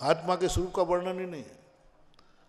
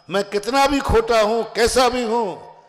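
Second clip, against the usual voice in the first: proudly voiced, pitched higher and louder; proud declaration; 5 semitones higher, 8 dB louder, slower than usual. Disciple boasting despite his own flaws, proud. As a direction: declare it with open pride, drawing out the end. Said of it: "गर्व करना".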